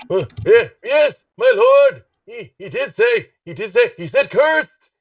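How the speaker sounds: high, loud, eager reply to a lord; flustered calling up. Acted. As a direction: call up to your master in a fluster. Gnome answers his lord loudly.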